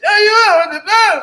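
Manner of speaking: high, loud crying imitation of Mike; hammed-up crying yell; mock crying yell. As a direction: whine it high like a crying man. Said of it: "'Cause Mike just".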